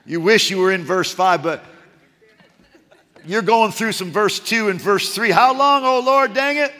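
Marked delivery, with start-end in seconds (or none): 5.3-6.7 frustrated. Retold or imitated. Frustrated prayer to God.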